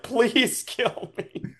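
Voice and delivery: slow, high, pained plea; pleading. Acted. Broken Slinky begging the party for death, slow and pained. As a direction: beg for death, drawn out. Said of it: "He's like".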